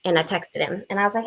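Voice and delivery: ordinary delivery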